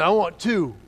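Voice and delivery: loud, greedy exclamation; excited kid ordering. Retold or imitated. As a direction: announce it boldly.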